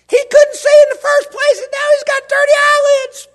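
very high, very loud whining wail; whiny complaining crowd. Pretend. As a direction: wail like scandalized busybodies. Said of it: "I can hear people now".

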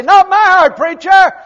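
loud, high, offended objection; a listener's indignant shout of denial; indignant protest. Retold or imitated. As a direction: shout it back at the preacher. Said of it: "you say".

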